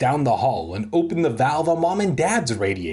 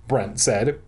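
ordinary delivery

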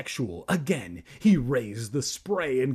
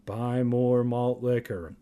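put-on deadpan kid voice; slower than usual. Joke line in kid voice.

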